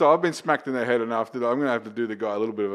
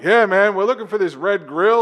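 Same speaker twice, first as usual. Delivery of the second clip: louder, higher, a bit brash; mock eager soldier; 7 semitones higher, 8 dB louder than usual. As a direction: play the eager guy from the next unit. Next unit asks about grill.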